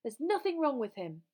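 high, loud defiant shout. A narrator's reading. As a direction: shout in someone's defence. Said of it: "Harry shouted".

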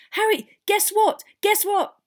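loud, very high, breathless little fan; highly excited. Acted. Boy with news for his hero, breathlessly excited. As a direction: burst with news you can't hold in. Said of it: "a highly excited, breathless voice".

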